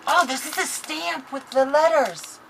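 thrilled at the crafting stuff; excited. Real, not acted.

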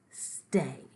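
firm one-word command, falling; firm instruction. A narrator's reading. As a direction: tell a pet to stay put. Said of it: "I instructed".

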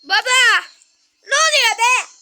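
loud, high, demanding answers; startled loud "what's going on"; alarmed shouting. Real, not acted. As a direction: yell over the noise. Startled parent asks about shouting.

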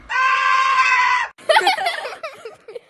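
a high, loud shrieking cackle of laughter; loud cackling laugh. Acted. Jer laughing hard at his own pun, big shrieking cackle. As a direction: cackle at your own bad joke. Wild laugh after honey joke.

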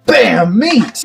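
high punchy chef call; excited exclamation. Pretend. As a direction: slam it down like a TV chef. Chef catchphrase repeated.

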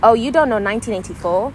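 loud, belittling disbelief; judgmental tone. Shown as a demonstration. Showing the hurtful way to ask about a book, judgmental. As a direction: question it so they feel bad for not knowing. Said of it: "it's very much the tone".

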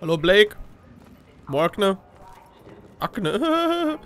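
ordinary talk, nothing marked

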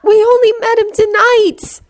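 high, pleading cry; sobbing. A narrator's reading. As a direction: protest tearfully in a high voice. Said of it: "Hermione sobbed".

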